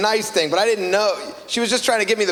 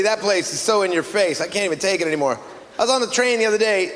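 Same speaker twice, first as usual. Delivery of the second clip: normal talking voice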